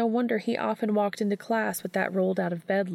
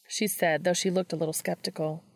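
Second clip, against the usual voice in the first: ordinary delivery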